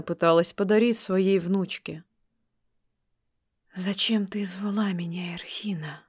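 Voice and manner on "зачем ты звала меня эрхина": ghostly unvoiced whisper. A narrator's reading. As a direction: breathe it out, no voice. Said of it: "шепнул призрак".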